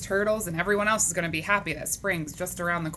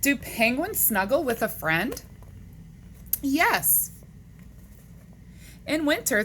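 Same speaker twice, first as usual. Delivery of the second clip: lively, higher-pitched picture-book reading; read-aloud to children; 5 semitones higher than usual. Acted. Teacher reading animal questions to boys and girls, animated read-aloud. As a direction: read it to kids with big pitch swings.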